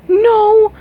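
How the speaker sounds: high desperate no; horrified cry. Acted. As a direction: scream in protest. Princess horrified at execution.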